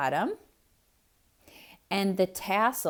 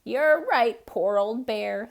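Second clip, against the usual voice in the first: mock sigh; 3 semitones higher, 5 dB louder, slower than usual. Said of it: "sighed Hare".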